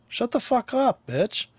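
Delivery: high-pitched mock outburst; exasperated retort. Driver telling the talking car off, exasperated retort. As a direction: tell it off, pitch jumping up.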